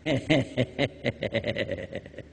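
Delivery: quiet real chuckle at the punchline; soft laugh. Real, not acted. Preacher to the crowd, chuckling at his punchline.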